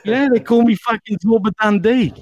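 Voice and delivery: put-on a joking Australian accent; mock Australian accent. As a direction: keep the bogus Aussie going. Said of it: "I fucking hate talking like this".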